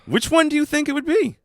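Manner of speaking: high teasing voice. Pretend. Teasing quiz question.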